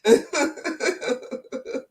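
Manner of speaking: talking through laughter; amused protest dissolving into laughter; laughing. Real, not acted. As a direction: let giggles break up the words, slowly. Laughing while teasing about the beer.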